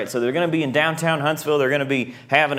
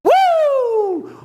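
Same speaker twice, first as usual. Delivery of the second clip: high loud whoop; excited whoop; 5 dB louder than usual. Acted. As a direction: cheer wildly. Hype cheer.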